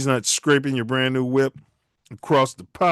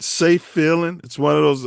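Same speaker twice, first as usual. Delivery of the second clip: ordinary delivery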